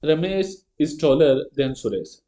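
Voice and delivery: slow and even, word by word; slow example reading. Shown as a demonstration. Teacher reading the comparative example aloud, slow. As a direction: let each word land for the class.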